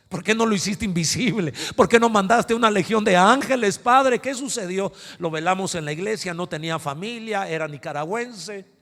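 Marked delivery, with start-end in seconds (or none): 0.1-4.9 higher, loud anguished pleading; anguished cry to God. Retold or imitated. Pastor arguing with God after Walter died, loud anguish. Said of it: "me peleé con Dios".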